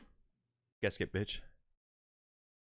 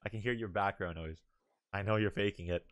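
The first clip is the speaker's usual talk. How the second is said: calm turning angry; 6 dB louder than usual. Real, not acted. Quiet then loud demands to speak.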